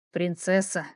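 low, silky, coaxing call; insinuating voice. Acted. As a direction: lure her in softly. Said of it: "Этот вкрадчивый голос".

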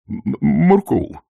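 slow, high, stammered name; stammering babble. A narrator's reading. Sergeant babbles the corporal's name in terror. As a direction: babble it in fright. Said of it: "пролепетал Колон".

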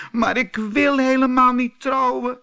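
high, loud and crying; wailing against the marriage; tearful high protest. A narrator's reading. Thumbelina bursts into tears at the mouse, loud tearful wail. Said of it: "huilde ze".

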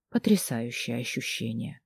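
ordinary delivery, nothing marked

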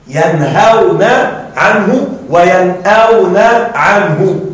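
very slow, spaced-out words; measured, stretched teaching delivery; slow word-by-word demonstration. Shown as a demonstration. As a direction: draw out each word for the class. Teacher breaks down the verse's verbs.

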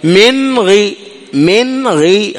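من غي said slowly, the noon with a brief hum that stops at once; drawn-out من غي, tongue drops right after the noon; short nasal sound, slow demo. Shown as a demonstration. Teacher showing students the brief nasal sound, slow and deliberate. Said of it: "شوف، تذهب إلى الغين".

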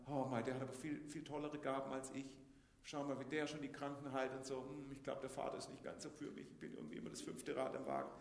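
soft, dejected muttering of someone who feels overlooked; quietly self-pitying complaint; insecure whining. Acted. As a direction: sigh it out like the forgotten child. Voicing an insecure believer's complaint.

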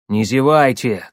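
ordinary delivery, nothing marked

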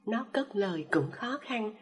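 ordinary delivery

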